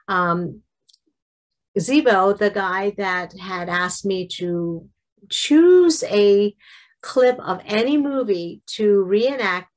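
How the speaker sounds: usual speaking voice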